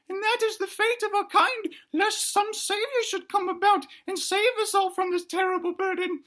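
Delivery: very high, crying little-creature voice; wailing child. Acted. Baby dinosaur wails to mama about the end. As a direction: sob out the doom prophecy.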